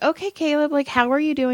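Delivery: high-pitched imitation of a counselor checking in; therapist. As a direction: lightly mimic a therapist greeting a teen. Therapist greets the boy.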